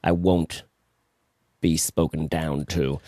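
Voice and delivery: very low, slow, mock-offended guru voice; Alan Watts impression. Host as Alan Watts refusing to be talked down to, low and slow. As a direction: drop low and slow in pretend indignation. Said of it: "my attempt at Alan Watts".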